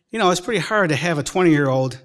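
normal talking voice

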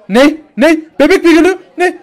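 high, loud disbelieving cries; panicked repeated questions; shocked shouting. Acted. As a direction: yell in total shock. News of baby coming.

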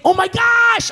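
high, loud mock gasp; shocked exclamation. Churchgoer seeing an angel, loud mock alarm.

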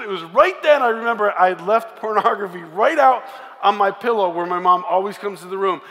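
ordinary talk, nothing marked

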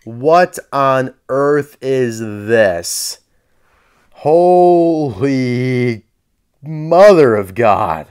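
slow, drawn-out horrified amazement; stunned, drawn-out exclamation; appalled disbelief. Real, not acted. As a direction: stretch each word out in disbelief. Reacting to an ugly toy car.

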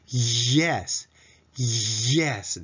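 Yes with a long, tense Y glide; English-style Yes. Shown as a demonstration. Teacher answering the quiz, exaggerated English Yes. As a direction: hold the opening Y, then release into Yes. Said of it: "イエスは英語らしい発音すると".

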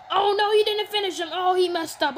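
loud gamer outburst at the screen; excited yelling. Real, not acted. Yelling at the game as his wrestler misses. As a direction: yell it at the game.

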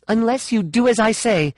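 high, forceful ultimatum; insistent, angry warning; threatening. A narrator's reading. Threat in story.

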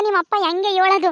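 usual speaking voice

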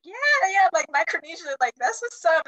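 high, loud excited cheer; retold excited yell, pitched high; screaming along excitedly. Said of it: "So I was screaming along with them".

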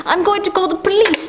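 usual speaking voice

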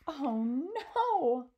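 high, loud cry of alarm; storybook girl crying out; dismayed cry. A narrator's reading. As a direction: wail it in acted horror. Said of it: "Sophie cried".

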